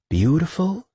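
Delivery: high, rising, cheery question; eager, lilting suggestion; bright prompting. A narrator's reading. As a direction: prompt brightly, pitch climbing to the end. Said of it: "Shelby prompted brightly".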